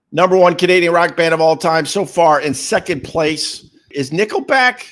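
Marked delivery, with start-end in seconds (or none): none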